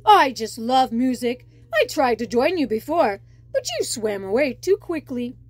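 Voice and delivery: an eager shark who wants friends; a hint of a lonely shark voice; friendly shark. Shark character speaking.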